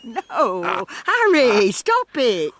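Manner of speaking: laughing cry, half protest; playful loud protest. Acted. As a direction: push him off, loud and giggly. Playful protest at husband's advances.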